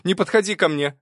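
high, loud warning cry; cried out. A narrator's reading. Girl backing away from the programmer, loud high cry. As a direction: cry out to keep him back. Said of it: "выкрикнула она".

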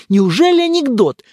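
high, loud disbelieving exclamation; loud, high-pitched scoffing surprise; incredulous. Acted. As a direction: challenge the friend in astonishment. Scoffing disbelief at friend's story.

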